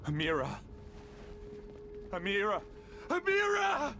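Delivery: desperate cry, rising each time; calling out. Acted. Calling out to lost Amira.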